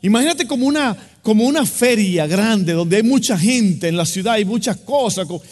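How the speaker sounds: fervent storytelling sermon; preaching at full force. Acted. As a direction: paint the scene for the congregation.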